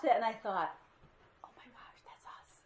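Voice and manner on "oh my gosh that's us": breathy stunned whisper; whispered realization. Real, not acted. Preacher realizing the story is about us, whispered. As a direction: whisper it as if it just dawned on you.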